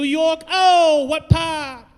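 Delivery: high, loud mock New York accent; hammed-up New York shout. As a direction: holler it like a brash New Yorker.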